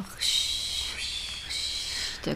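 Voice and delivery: breathy whispered "フシ、フシ" like rushing water; whispered river sound. Shown as a demonstration. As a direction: hiss out "フシ" softly. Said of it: "川、川だと".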